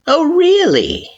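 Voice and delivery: high, slow, teasing doubt; smiling, drawing it out; a knowing, menacing question; sly smile. Acted. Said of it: "gave a small smile".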